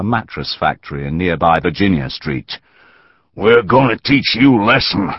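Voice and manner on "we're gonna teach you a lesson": gloating threat, a little higher; crowing. A narrator's reading. Bearded man gloating over the Yankee captive. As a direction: relish the threat. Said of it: "the big bearded man crowed".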